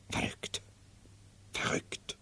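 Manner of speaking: whispered. Acted. Madman whispering the word to his servants, sharing a secret.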